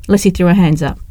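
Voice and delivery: plain reading voice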